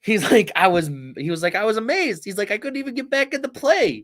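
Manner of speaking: high, giggly amazed retelling; amazed, laughing. Retold or imitated. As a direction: tell it like you still can't believe it.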